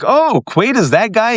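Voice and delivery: high, slow mock astonishment; surprised viewer. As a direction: act out a puzzled moviegoer's discovery. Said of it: "And you're like".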